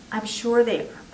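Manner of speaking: plain reading voice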